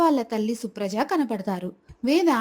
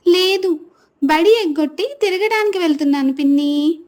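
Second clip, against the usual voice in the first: put-on higher-pitched child voice; little girl's retort; 7 semitones higher, 3 dB louder than usual. Girl talking back to her aunt, high-voiced retort.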